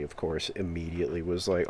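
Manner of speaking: ordinary delivery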